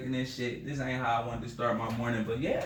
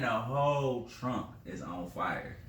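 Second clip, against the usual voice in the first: ordinary delivery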